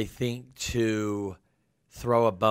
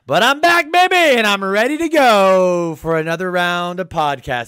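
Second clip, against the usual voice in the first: much higher, much louder triumphant shout; excited; 10 semitones higher, 14 dB louder than usual. Real, not acted. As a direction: announce your comeback at full volume. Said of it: "my voice sounded so terrible".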